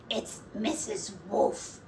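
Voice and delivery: slow, breathy sinister wolf voice; sly wolf babysitter. Acted. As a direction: purr the name slyly. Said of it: "said the babysitter".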